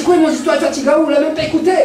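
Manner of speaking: high-pitched, offended disbelief; indignant protest. Acted. Tijan answering the king who rejected the story, indignant. Storyteller disputes the king's verdict.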